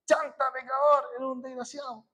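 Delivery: mock street yell. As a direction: hurl it like a stranger on the street. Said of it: "te grita". (grito burlón de un desconocido en la calle)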